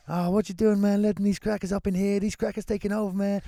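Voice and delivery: mock rant at the shop clerk; retold angry ranting. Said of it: "he starts barking at the guy".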